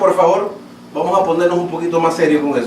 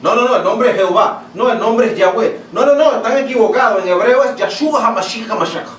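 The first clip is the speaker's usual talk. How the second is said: mock online debaters; 3 semitones higher than usual. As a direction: parody the people who keep correcting each other about the name, rising to an insistent peak. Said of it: "Así tú lo has oído por internet". (imitación burlona de los que discuten el nombre en internet)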